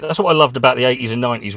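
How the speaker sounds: ordinary talk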